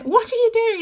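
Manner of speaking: put-on a mum catching her child mid-climb; surprised mum. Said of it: "being like".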